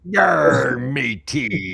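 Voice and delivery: gruff pirate greeting. Retold or imitated. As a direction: growl it like a pirate. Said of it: "they're looking for a pirate".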